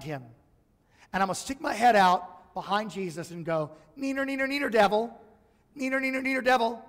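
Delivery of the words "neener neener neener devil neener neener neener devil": put-on high, sing-song neener taunt like a kid; childish taunt. Preacher hiding behind Jesus, taunting the devil in a singsong. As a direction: tease the devil like a schoolyard brat.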